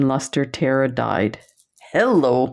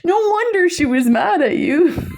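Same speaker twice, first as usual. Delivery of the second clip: quoting Jen. Retold or imitated. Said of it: "She's like".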